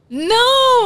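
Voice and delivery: high, loud, drawn-out refusal; protesting cry. Real, not acted. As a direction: wail it in protest. Protests the accent rule.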